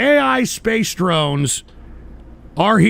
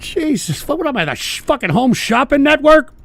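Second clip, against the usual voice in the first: higher, incredulous sarcastic outburst; exasperated rant; 6 semitones higher than usual. Real, not acted. Streamer mocking chat's cup holder joke, exasperated. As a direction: pitch it up in disbelief.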